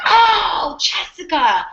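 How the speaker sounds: mock grandma swooning over a visitor; grandma's delighted greeting. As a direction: gush over the girl like a fond Italian grandma. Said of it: "she would be like".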